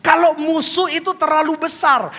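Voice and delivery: loud, driving sermon delivery; full-force preaching. Acted. Preacher rousing the congregation, loud full-force preaching. Preacher drives home his point.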